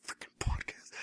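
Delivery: mock wife whispering an annoyed curse; whispered grumble. Wife grumbling at the podcast, whispered. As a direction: breathe it under your breath. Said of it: "She's like".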